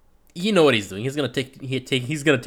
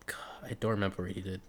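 fast unvoiced whisper; whispered aside; 4 semitones lower, 13 dB quieter, much faster than usual. Real, not acted. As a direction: mutter it to yourself under your breath. Aside to self.

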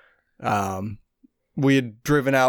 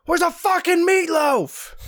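movie quote yell. Retold or imitated. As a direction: bellow it like a spoiled grown son. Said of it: "And he yells".

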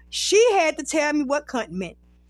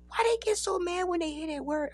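higher, much softer voice; hushed question; 4 semitones higher, 8 dB quieter, faster than usual. Retold or imitated. Asking best friend about the word in the airport, hushed. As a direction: ask it small and quiet, imitating. Question asked again after being shushed.

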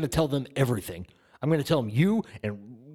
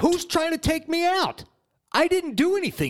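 high-pitched, panicked protest; raised-voice panic; 14 semitones higher than usual. Acted. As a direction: protest your innocence in a high, alarmed voice. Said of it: "Lower your voice".

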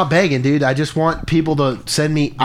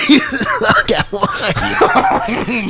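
choking while laughing; much slower than usual. Real, not acted. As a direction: cough and choke through the words. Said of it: "I'm choking".

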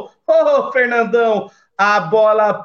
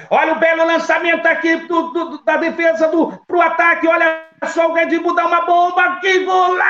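rising excited commentary; 6 semitones higher, faster than usual. Acted. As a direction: build up fast to the goal. Goal chance, rising excitement.

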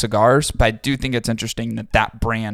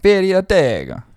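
higher Italian accent on Fury Tego, rolled and bright; 8 semitones higher, 3 dB louder than usual. Shown as a demonstration. Asked by his cohost, he says the name in an Italian accent. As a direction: say the brand name like an Italian would. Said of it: "Say it, say it Italian".

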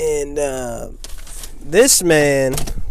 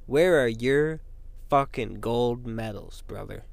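slow, dropping, gloating taunt; Kurt Angle taunt; 3 semitones lower, 13 dB quieter than usual. Retold or imitated. Taunting critics with two gold medals, slow gloating brag. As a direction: lord it over them, slowing down at the end. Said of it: "like Kurt Angle".

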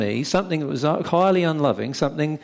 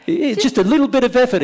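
mock indignant demand; 10 semitones higher, 4 dB louder, faster than usual. Voicing a woman's resentful demand.

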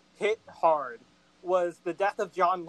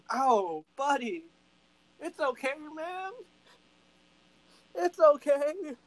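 consoling; 7 semitones higher than usual. Retold or imitated. Comforting Alexander after his son dies.

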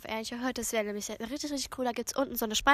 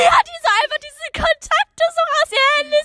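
much higher, louder, laughing through it; excited giggly outburst; 13 semitones higher, 11 dB louder than usual. Real, not acted. Host squealing as her friend reads out phone contacts.

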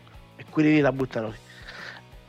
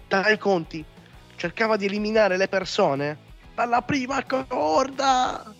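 exasperated; 9 semitones higher than usual. Real, not acted. Outraged rant about wrestler.

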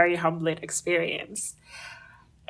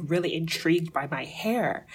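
normal talking voice

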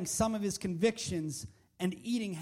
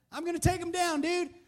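put-on cocky young David; 7 semitones higher than usual. David boasting to the king.

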